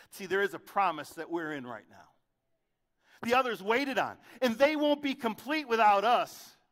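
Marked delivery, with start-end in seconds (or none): 3.2-6.6 louder, higher sermon climb; preaching at full force. Acted. Preacher to the congregation, rising loud preaching. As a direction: drive it home to the crowd.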